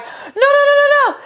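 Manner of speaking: very high, loud run of pleading; acted high-pitched panic; imitated panicking nurse. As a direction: squeal a frantic plea, loud and high.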